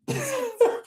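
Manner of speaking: high squeaky giggle; short high-pitched laugh. Real, not acted. Laughing after quoting Samuel.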